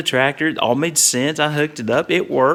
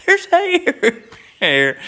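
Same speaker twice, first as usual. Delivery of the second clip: put-on much higher, thrilled kid voice; giddy delight. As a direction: squeal it like a kid in paradise. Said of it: "it's like".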